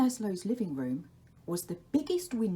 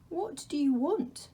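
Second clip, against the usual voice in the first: put-on high, timid child voice; a small boy asking up into the dark; Laszlo asking; 9 semitones higher than usual. Laszlo, woken, asks the Dark in a high voice.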